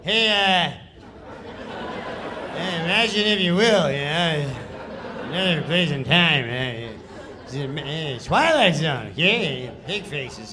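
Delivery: loud, laughing, careless Twilight Zone read; half-hearted narrator read with laughs; Norm's lazy read. Retold or imitated. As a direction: toss off the narration, chuckling through it. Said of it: "this is how he reads the sketch".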